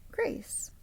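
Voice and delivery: plain reading voice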